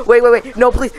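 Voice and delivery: urgent, rising panic; panicked pleading. Real, not acted. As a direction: beg quickly, alarmed.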